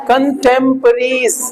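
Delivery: कंटेम्परेरीज drawn out slowly, syllable by syllable; teacher modelling कंटेम्परेरीज slowly for repetition; slow model pronunciation. Shown as a demonstration. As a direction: say कंटेम्परेरीज slowly so the class can repeat it. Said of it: "बोलो".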